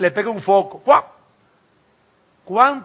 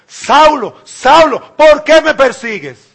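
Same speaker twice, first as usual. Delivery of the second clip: loud, higher call of the name; commanding call; 6 semitones higher, 7 dB louder, slower than usual. Acted. Jesus calls out to Saul, loud and commanding. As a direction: call him out from heaven.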